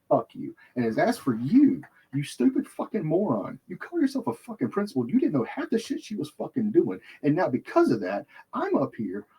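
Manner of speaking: high, heated tirade; angry yelling. Retold or imitated. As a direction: chew out the principal. Yelling at principal.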